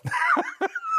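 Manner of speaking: sudden high-pitched laugh; high laugh at his own joke; high squealing laugh. Real, not acted. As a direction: burst into a squeaky giggle.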